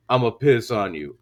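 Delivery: low mock seducer. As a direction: imitate him in a deep, matter-of-fact voice. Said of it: "And then he goes".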